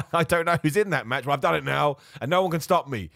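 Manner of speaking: normal talking voice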